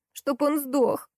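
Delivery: high-pitched, spiteful squeal; squealed curse. A narrator's reading. Salesgirl curses her husband, squealing. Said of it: "взвизгнула в ответ продавщица".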